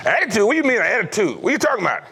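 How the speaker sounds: high, indignant disbelief at "Attitude?"; a father who can't believe the word; baffled dad. Retold or imitated.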